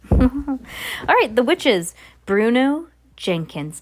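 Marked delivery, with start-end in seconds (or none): none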